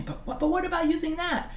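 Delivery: small, high-pitched student question. Retold or imitated. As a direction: mimic a student raising an objection. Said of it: "Everybody always asks me".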